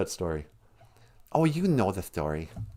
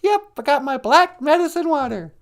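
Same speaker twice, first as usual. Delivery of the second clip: normal talking voice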